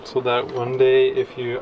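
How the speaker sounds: ordinary talk, nothing marked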